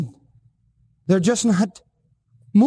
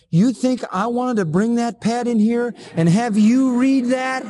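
raised pitch, pleading retold question; a man defending himself, voiced higher; quoted confession; 6 semitones higher than usual. Pastor quotes distraught friend.

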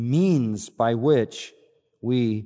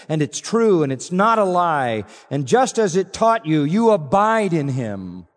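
forceful preaching; 4 semitones higher, 4 dB louder than usual. Real, not acted. Preacher drives point home.

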